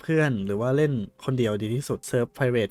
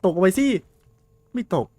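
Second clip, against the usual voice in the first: higher, louder urging call; urging shout; 8 semitones higher, 5 dB louder than usual. Real, not acted. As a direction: shout it down the pit at the monster. Urging monster into the pit. (ตะโกนเร่งเสียงสูง)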